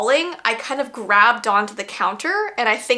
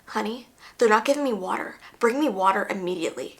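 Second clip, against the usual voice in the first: soft, quick, matter-of-fact order; hushed instructions to her husband, fast; secret phone call; 2 semitones lower, 5 dB quieter, faster than usual. Retold or imitated. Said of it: "went from super desperate and hoarse-voiced to totally normal".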